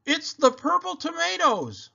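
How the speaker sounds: excited announcement. A narrator's reading. Shouting over music.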